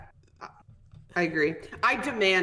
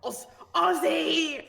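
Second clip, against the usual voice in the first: high, drawn-out mock Aussie; hammed-up Australian accent; 9 semitones higher, slower than usual. Shown as a demonstration. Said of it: "Australian accent ever".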